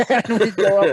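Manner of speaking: laughing. Real, not acted. Storyteller cracked up by his own retold exchange, laughing. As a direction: talk through a burst of laughter.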